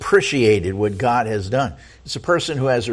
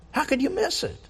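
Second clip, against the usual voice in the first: soft high question; 14 semitones higher, 9 dB quieter, faster than usual. Acted. Preacher repeats his question.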